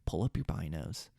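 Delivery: low, softened voice, short of a whisper; hushed aside. Retold or imitated. Hunter quietly alerting his cameraman behind him, hushed. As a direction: keep it down, for one listener only. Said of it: "I should have whispered to Dylan".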